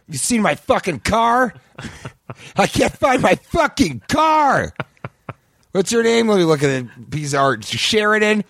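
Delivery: high, slow, shrieking rant, close to tears; unhinged screech. Acted. A deranged caricaturist screaming high and slow at customers. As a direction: shriek it out like a lunatic artist.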